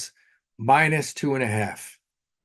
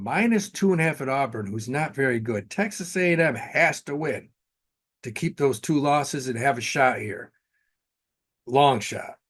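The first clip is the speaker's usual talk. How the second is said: ordinary talk, nothing marked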